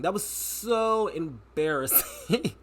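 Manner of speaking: slow, stretched out, leaning on so; drawn-out emphasis. Real, not acted. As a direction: drag out "so" for emphasis. Repeated for emphasis.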